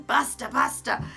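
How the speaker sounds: loud, high call of Buster. Retold or imitated. Dad hushing his boys at Mass, loud call. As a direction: snap at the boys loudly. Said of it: "you'd be going".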